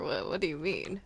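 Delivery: normal talking voice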